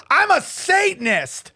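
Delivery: high mock-proud declaration; imitating the heckler. Heckler answering the comedian, mock proud. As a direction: announce it like a smug heckler. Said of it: "She goes".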